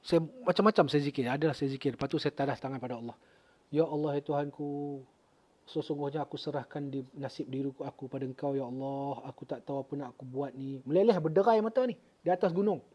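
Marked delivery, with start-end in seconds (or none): 3.7-10.8 softly surrendering to God; a quiet, broken plea; hushed prayer. Retold or imitated.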